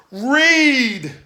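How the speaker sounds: "Read!" shouted high and loud as the punchline; "Read!" called out high and loud. Shown as a demonstration. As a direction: belt out "Read!" as the big reveal. Third repeat, punchline.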